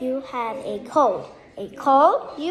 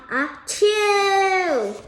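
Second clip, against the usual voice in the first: building ah then a higher, louder chew; hammed-up sneeze, high and loud; fake sneeze; 7 semitones higher, 4 dB louder than usual. Child acting out the elephant's sneeze.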